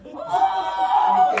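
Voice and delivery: long, high, loud whoop; excited. Acted. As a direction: let out an overexcited holler. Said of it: "he was so excited".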